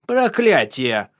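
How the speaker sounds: slow, forceful exclamation; loud curse. Acted. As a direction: bark the curse out slowly. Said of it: "громко произнёс граф".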